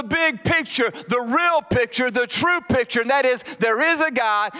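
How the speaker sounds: rally the church against the devil; fervent preaching. Acted.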